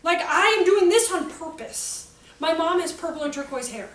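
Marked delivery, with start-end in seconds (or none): 0.0-2.1 playful defiance. Real, not acted. Defiant joking declaration.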